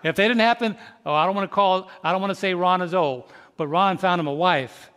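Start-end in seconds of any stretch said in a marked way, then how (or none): none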